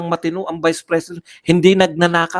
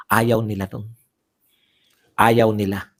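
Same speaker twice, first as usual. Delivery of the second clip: ordinary talk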